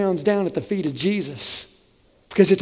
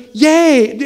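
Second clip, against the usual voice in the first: high, loud yay; happy cheer; 5 semitones higher, 6 dB louder than usual. Pretend. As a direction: cheer it out glass half full. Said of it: "I hear".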